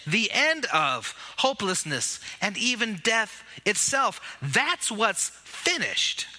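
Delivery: ordinary talk, nothing marked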